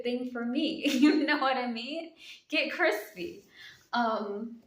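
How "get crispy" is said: normal talking voice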